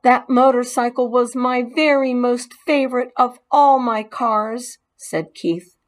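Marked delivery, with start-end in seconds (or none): 0.0-4.8 higher, wistful; missing his favorite toy; a touch of sad boy. Acted. Boy mourning his lost motorcycle, mildly sad.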